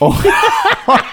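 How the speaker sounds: risotada muy aguda e incontenible; carcajada aguda. Real, not acted. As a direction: estalla de risa por el chiste. Bursts out laughing at the pun. (carcajada chillona de sorpresa)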